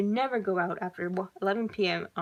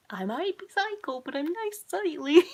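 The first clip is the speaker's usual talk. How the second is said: playful creepy; 8 semitones higher, 3 dB louder than usual. Pretend. Playful creepy self-description.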